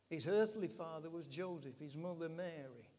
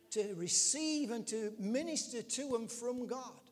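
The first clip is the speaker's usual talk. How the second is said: loud, raised preaching voice; higher, louder, insistent sermon delivery; impassioned preaching; 5 semitones higher, 7 dB louder than usual. Real, not acted. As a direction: preach it out with force. Preaches at full force.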